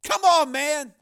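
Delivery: high, loud nervous pleading; uneasy. Acted. Pimp facing a rifle, uneasy and pleading. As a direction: plead uneasily, pitched far up. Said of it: "he said uneasily".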